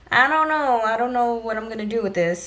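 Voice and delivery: ordinary delivery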